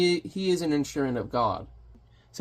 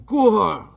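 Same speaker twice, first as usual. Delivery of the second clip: loud high mock yell of pain; a cartoon hero hit hard; 7 semitones higher, 6 dB louder than usual. Hellboy taking the hammer on his spine, hammed-up yell.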